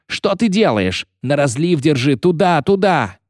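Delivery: high, frantic shouting; strained yelling. A narrator's reading. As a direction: yell directions desperately. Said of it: "надрывался Фиш". (надрывные отчаянные крики)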